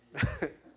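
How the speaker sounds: high, loud burst of laughter; short high-pitched laugh; real laugh. Real, not acted. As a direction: laugh out loud at your own uncertainty. Amused at unresolved translation question.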